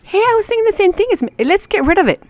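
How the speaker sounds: very high, quick voice of agreement; eager citizen. Pretend. Another citizen agrees with the group, eager high-pitched voice. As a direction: jump in high, keen to agree.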